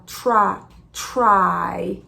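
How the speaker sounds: "try" with a puff of air and an English R; breathy T, curled R in "try"; aspirated try. Shown as a demonstration. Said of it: "faz esse som de T aspirado".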